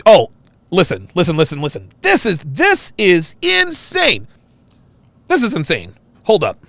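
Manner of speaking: usual speaking voice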